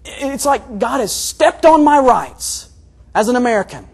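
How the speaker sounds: loud, high indignant outburst; lashing out. Acted. Preacher acting out his gut response to suffering, lashing out. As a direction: protest hotly, building to a shout. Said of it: "I just want to lash out".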